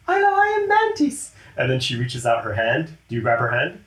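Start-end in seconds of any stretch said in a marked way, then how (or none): 0.1-1.3 very high, airy, gentle alien voice; Mantis voice. Acted. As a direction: float it up high, sweet and timid.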